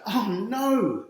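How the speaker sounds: high, loud panicked exclaim; alarmed cry. A narrator's reading. Fan watching the close finish, high alarmed cry. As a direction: cry out in dread.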